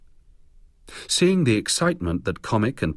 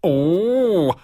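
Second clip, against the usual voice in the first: higher, louder leering exclamation; a loud, lecherous "oh" up high; leering delight at the co-star who snapped back; 11 semitones higher, 6 dB louder than usual. A narrator's reading. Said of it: "Williams leered".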